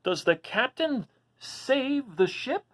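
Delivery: long for the ending like a last sip; pleading dragon voice. Acted. Reading aloud to kids, voicing Duncan's pleading wish.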